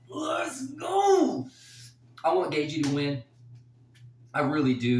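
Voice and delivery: high, loud cry of hype; excited shout. Real, not acted. Fan shouting his excitement for Gaethje to win. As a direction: shout it out for the fighter you love. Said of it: "I'm so excited".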